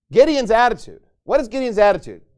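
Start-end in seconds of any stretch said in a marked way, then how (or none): none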